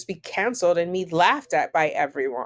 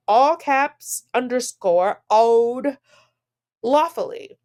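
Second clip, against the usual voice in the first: higher and louder, hammed-up reading of the caps; mock emphasis; 4 semitones higher, 4 dB louder, slower than usual. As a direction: ham up the shouted caps. Mock-reading emphatic letter formatting.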